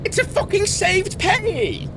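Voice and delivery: high, slow outraged question; exasperated retort. Retold or imitated. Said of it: "I'm like".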